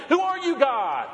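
a pretend grumbler's high-pitched indignant challenge; mock defiant. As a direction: snap back at God like a sulky child. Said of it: "we growl, we grumble, we complain".

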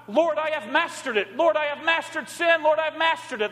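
high-pitched mock boast; mock boasting prayer. Church member boasting to God of mastering sin, mock proud. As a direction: proclaim it high, like a false boast.

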